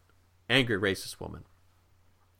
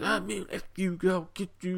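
a hint of the drunk man; 9 semitones higher, 3 dB quieter than usual. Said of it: "And the guy's".

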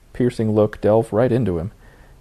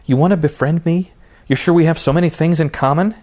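higher, louder bitter challenge; growing louder; 8 semitones higher, 4 dB louder than usual. Acted. Raven turns on Jason, voice climbing in anger. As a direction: let the anger start to rise. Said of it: "her voice grew louder".

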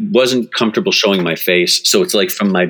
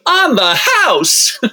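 high, slow diva proclamation; grand Mame flourish; much slower than usual. Retold or imitated. Cashier with arms raised, giving it free, grandly high and slow. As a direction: sing it out like Mame on stage. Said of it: "raises his arms up like Mame".

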